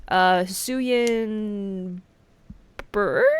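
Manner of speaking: drawn-out searching for the word; slow, unsure. Real, not acted. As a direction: stretch the word out while trying to remember Hisuian. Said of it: "I forget what bird it is".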